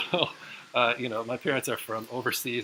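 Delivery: ordinary delivery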